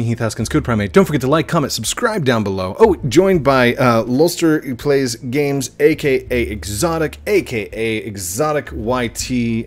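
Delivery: loud, high-energy host sign-off; video outro. Real, not acted. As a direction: belt out the thanks and plugs.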